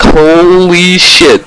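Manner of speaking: very slow, drawn-out gasp; slow amazed holy shit; stunned. Retold or imitated. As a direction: stretch it out in disbelief. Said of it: "I was just like".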